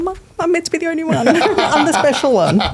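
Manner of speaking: high-pitched playful whine, breaking into laughter; mock upset. Guest jokes about being the special one, mock upset. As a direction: put on a high, hammed-up hurt voice.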